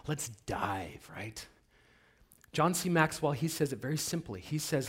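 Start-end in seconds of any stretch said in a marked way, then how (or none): 0.1-1.5 quick, conspiratorial whisper. Real, not acted. Speaker inviting the audience in, whispering. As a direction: whisper it to draw them in. Teasing lead-in to topic.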